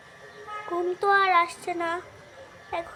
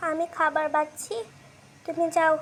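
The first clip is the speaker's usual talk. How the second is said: ordinary talk, nothing marked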